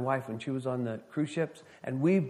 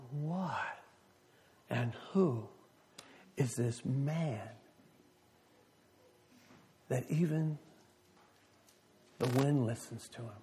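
awed hush; 8 dB quieter than usual. Acted. Disciples awed by calmed storm.

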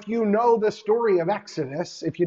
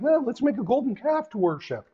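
mocking the people; 6 semitones higher than usual. Mocking the people's foolish idea.

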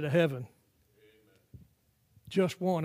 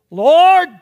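crying out; 11 semitones higher, 17 dB louder than usual. Retold or imitated. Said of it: "first question Paul the Apostle said".

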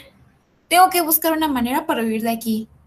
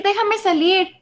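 shouting; 7 semitones higher than usual. Acted. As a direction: cry it out again, rising. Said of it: "una voz en su cabeza gritó". (grito agudo ascendente)

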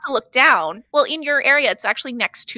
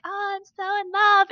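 high, soft mock lovestruck girlfriend; dreamy and lovestruck; 10 semitones higher, 4 dB quieter than usual. Girlfriend in her own daze on a scooter, dreamy lovestruck voice. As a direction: swoon it softly, pitched up high. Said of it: "in her own daze being like".

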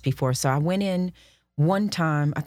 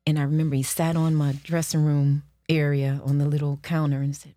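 ordinary delivery

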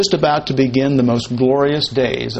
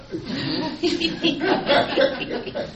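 breathy laugh; 8 dB quieter than usual. Real, not acted. Laughing at the acted exchange.

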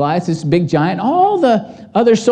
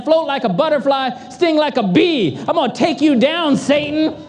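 higher, cocky boxer's taunt; Muhammad Ali boast; 7 semitones higher than usual. Retold or imitated. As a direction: strut it like a champ calling out Satan. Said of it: "He was like Muhammad Ali".

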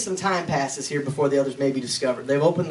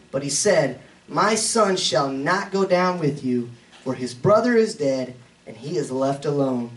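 scripture reading; 4 semitones higher, 4 dB louder than usual. Acted. Reads scripture aloud in sermon.

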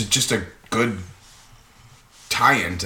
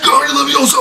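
high, loud battle incantation; shouted spell; 7 dB louder than usual. Pretend. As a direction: yell the spell mid-fight. Mock spell in a fight.

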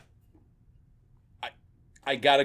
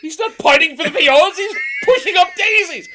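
higher and louder, laughing through it; hammed-up Monty Python shopkeeper; 8 dB louder than usual. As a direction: belt the parrot line like an outraged customer. Dead Parrot quote, acted.

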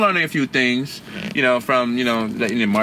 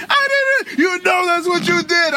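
very high, slow wailing protest; panicked kid denial; slower than usual. Retold or imitated. Meme kid denying, very high wailing protest. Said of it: "It's like".

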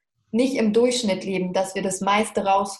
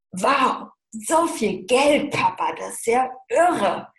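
put-on slow, awed, higher child's wow; childlike wonder; 4 semitones higher, slower than usual. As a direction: marvel at it like a five-year-old. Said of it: "voller kindlicher Vorfreude".

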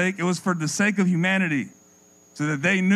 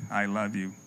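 programmed wife; 9 semitones lower, 8 dB quieter than usual. Pretend. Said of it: "I said".

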